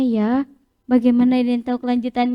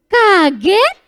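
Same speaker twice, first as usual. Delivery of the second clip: louder, startled call of the word Kaget with a rise; surprised; 4 semitones higher, 7 dB louder than usual. Shown as a demonstration. Teacher calls out the surprise expression, startled. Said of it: "Tepuk ekspresi".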